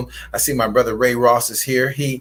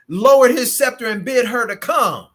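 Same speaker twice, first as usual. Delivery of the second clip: ordinary delivery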